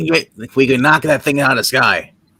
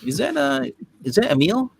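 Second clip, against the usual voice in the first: high voice; 11 semitones higher, 7 dB quieter than usual. Acted. Asking in a higher voice.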